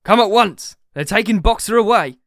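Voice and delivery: high, urgent summons; urgent shout. A narrator's reading. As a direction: shout the alarm to the others. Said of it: "he shouted".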